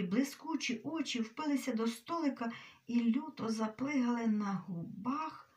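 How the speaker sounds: plain reading voice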